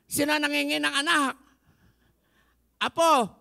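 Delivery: loud, high, fast call to the room. Real, not acted. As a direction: call out to the crowd. Calling out to congregation.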